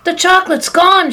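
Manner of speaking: high, loud shout of alarm. A narrator's reading. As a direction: yell it out, arms waving. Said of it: "shouted Grandpa Joe".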